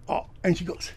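ordinary delivery

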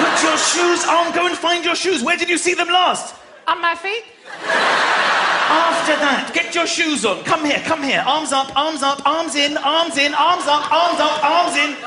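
high, pushy parent commands; harried parent. Retold or imitated. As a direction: hurry the kids into their clothes.

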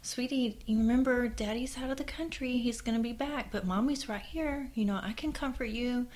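put-on high, gentle coaxing to a toddler; mother calling her hurt toddler, high and soothing; soothing mommy voice. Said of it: "And so I'm like".

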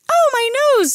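put-on shrill teenage-girl wail of injury; Marcia Brady. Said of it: "It's a very Marcia Brady".

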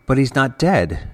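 understated gasp of disbelief in a narrator's voice; gasped protest. A narrator's reading. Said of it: "gasped Ivo".

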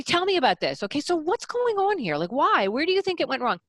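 matched annoyance. Shown as a demonstration. Coach showing the right annoyed clarifying tone to the mother. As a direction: sound as annoyed as he is. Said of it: "It has to be".

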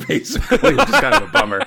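high, laughing over-dramatic lament. Real, not acted. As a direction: laugh through the complaint. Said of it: "just to be real over dramatic about it".

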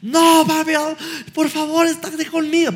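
high wailing imitation of a frightened son; desperate pleading child. Son begs dad to stay, imitated desperate pleading. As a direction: beg like a panicking little boy, acted out. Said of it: "Pero así".

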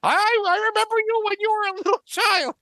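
very high, squeaky wrestler impression; mock greeting in a high-pitched voice; Mankind. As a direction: hold a high, silly cartoon voice throughout. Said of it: "He sounded like Mister Bill when he talked".

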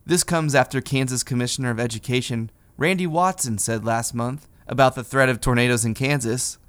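ordinary talk

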